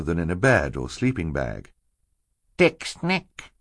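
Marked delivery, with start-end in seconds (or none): 2.6-3.5 high infant's questioning babble; baby talk. A narrator's reading.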